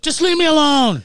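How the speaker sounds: high, loud, exasperated mock yell. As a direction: finally yell it back, hammed up. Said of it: "I was like".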